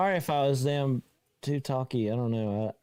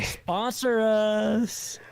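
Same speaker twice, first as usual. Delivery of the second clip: drawn-out joking plea; 9 semitones higher, 3 dB louder, much slower than usual. Pretend.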